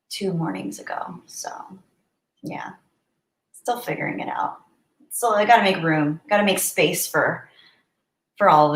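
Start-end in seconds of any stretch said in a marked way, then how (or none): 0.1-4.6 whispered grief. Real, not acted. Grieving her dog.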